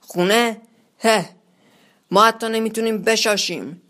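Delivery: mild shouted mockery; Strangelove. A narrator's reading. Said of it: "استرنجلاف فریاد زد".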